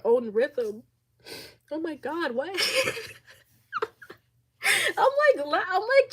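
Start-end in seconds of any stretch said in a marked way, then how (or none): 1.7-6.1 much higher, laughing it off while crying; half laugh, half sob, trying to lighten it; laughing through tears. Real, not acted. Laughing to viewers to shake off the tears, high and breaking. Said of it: "trying to offset the emotional".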